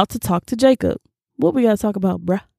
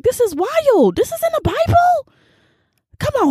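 much higher, disbelieving exclamation; can't believe it's in there; stunned at a Bible verse, voice shooting up; real shock. Real, not acted.

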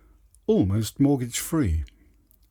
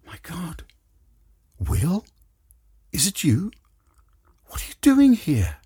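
rising, higher cries of disbelief; astonished; 8 semitones higher than usual. Acted. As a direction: gasp it out at an unexpected face. Shocked at seeing old flame.

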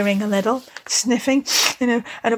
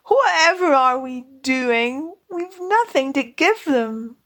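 higher, near-tears lament; tearful despair; 4 semitones higher than usual. Acted. Mole with nothing to give his guests, tearful despair. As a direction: wail it as if tears are coming. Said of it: "with tears impending".

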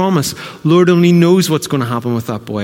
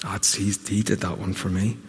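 quiet wondering at the cross; softly spoken confession; hushed, softer; 6 semitones lower, 11 dB quieter than usual. Retold or imitated. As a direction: let it fall away in awe. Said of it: "stare at Jesus on the cross and go".

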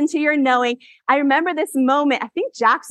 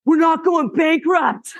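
husband's happy shout; 5 semitones higher, slower than usual. Retold or imitated. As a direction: bellow it slowly like the overjoyed husband. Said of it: "he's like".

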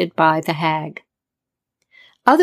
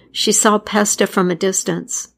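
plain reading voice

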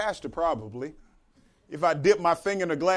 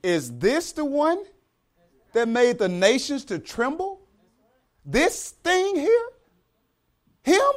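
slow, much higher, scornful astonishment; incredulous disbelief; 6 semitones higher, slower than usual. Pretend. Believers seeing the devil as he really is, slow scornful disbelief. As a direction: sneer in disbelief at how small he is. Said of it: "we're gonna say".

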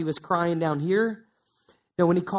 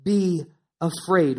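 slow, solemn quote; much slower than usual. Acted. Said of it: "but God says".

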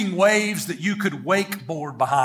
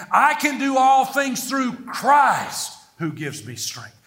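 "Christ" shouted and held, higher slow verse; stress on CHRIST; 4 semitones higher, slower than usual. Shown as a demonstration. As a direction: build up to a big, long "Christ". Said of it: "let's emphasize him in this one".

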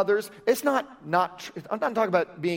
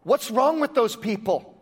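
normal talking voice

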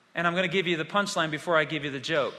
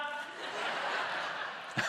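laugh; 11 dB quieter than usual. Real, not acted. Laughs at the girl's answer.

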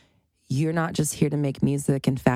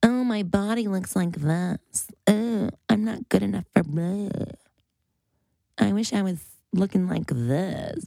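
higher, whiny self-critical voice mocked; mocking insecure self-talk; 4 semitones higher than usual. To listeners, mocking her old insecure self, whiny. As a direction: parody her old body-obsessed self. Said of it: "obsessed with".

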